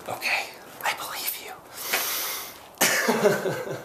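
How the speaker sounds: hushed whisper of giving in; a sheepish whispered answer to his own scolding. Pretend. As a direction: whisper it like you're backing down. Whispered reply to his own threat.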